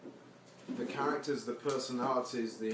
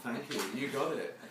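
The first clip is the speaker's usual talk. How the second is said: laughing reply. Retold or imitated. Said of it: "I'd say".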